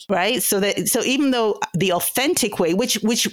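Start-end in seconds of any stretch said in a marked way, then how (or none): none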